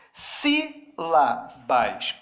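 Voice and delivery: Sílabas stretched very slowly, syllable by syllable; teacher modelling Sílabas very slowly; slow model word. Shown as a demonstration. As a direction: drag out Sílabas so each syllable stands apart. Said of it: "Pense a mesma coisa".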